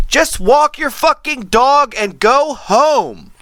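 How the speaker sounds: high, slow, drawn-out yell out a car window; retold exasperated shout; shouted command. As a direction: holler it down the street, each word stretched.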